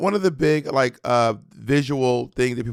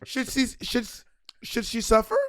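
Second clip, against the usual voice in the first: imitating Wendy Williams; 6 semitones higher than usual. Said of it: "She just goes".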